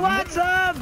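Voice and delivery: high, loud challenge; battle shout. Real, not acted. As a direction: yell it out at the enemy. Shouts out mid-fight.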